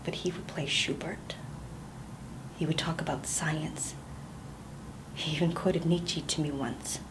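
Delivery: hushed, unvoiced confession; whispered confession. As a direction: breathe it out as a secret nobody else may hear.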